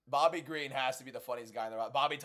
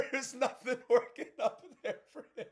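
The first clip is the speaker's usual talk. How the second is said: words squeezed out through high laughter; can't get it out for laughing; cracking up mid-sentence; 9 semitones higher than usual. Real, not acted. Streamer cracking up at Bobby.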